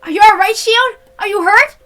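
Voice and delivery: loud, high worried calling; worried shout. Acted. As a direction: call out to her loud and anxious.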